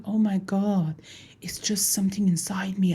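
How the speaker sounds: hushed, breathy thinking-aloud whisper; inner whisper. Acted. Mister Circle hearing a voice inside, hushed whisper. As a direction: whisper it like a private thought. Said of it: "the Mister Circle start thinking".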